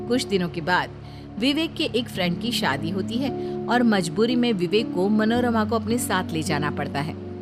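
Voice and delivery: usual speaking voice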